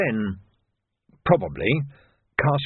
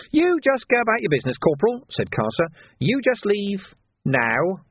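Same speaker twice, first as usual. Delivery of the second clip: higher, sneering villain voice; sly thug Carcer; 10 semitones higher than usual. A narrator's reading. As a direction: voice Carcer as a grinning menace.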